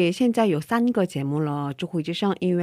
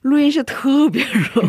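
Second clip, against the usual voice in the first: laughing; 7 semitones higher, 4 dB louder than usual. Real, not acted. Laughing about hot studio.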